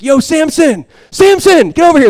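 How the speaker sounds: high, loud holler to a sheep; calling out. Retold or imitated. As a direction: shout across the pen to summon your animal. Man calls his sheep across field.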